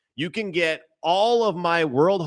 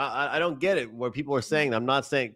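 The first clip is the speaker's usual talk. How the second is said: ordinary delivery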